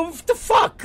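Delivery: high, sharp shout of disbelief; incredulous shout. Retold or imitated. Reacting to his wife's hairdresser worry, incredulous shout. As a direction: yell it like she's lost her mind. Arguing with wife over cover story.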